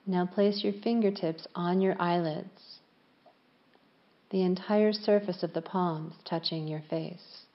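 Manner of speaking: calm yoga nidra voice with long pauses; unhurried, evenly pitched relaxation guidance; guided meditation. Real, not acted. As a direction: guide them gently into stillness, leaving space after each phrase.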